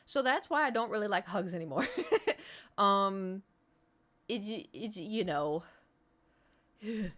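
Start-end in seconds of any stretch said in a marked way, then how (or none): 1.9-2.7 short high rueful laugh. Real, not acted. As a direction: laugh it off awkwardly. Laugh after sad story.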